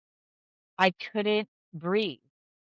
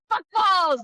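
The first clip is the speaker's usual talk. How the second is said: high comic burst of swearing; mock angry outburst; 9 semitones higher, 4 dB louder than usual. Woman showing how she gets angry, mock outburst. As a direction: blurt it as a hammed-up curse. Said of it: "I get angry, I'm like".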